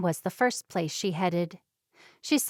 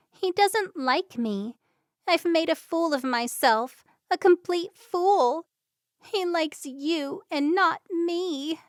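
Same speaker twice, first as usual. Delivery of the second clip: higher, slow, tearful lament; crying into her hands, much higher and slower; sobbing; 9 semitones higher than usual. Acted. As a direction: let it spill out between sobs. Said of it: "Gabby put her hands up to her face and sobbed".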